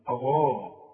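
غاء slow with a thick gh; heavy ghayn. Shown as a demonstration. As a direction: draw out غاء emphatically. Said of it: "مفخم كده". (غاء بغين مفخمة وببطء)

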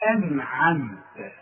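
أنعمت very slowly, ending in -ta; precise articulation. Shown as a demonstration. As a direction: sound out each letter of أنعمت. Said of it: "تكون دقيق في إخراج هذه الكلمة". (أنعمت ببطء وبتاء مفتوحة)

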